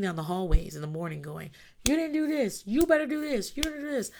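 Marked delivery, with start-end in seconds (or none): 1.9-4.1 high-pitched nagging parent; zrzędzący rodzic. Acted. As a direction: nag down the hallway, pitched up.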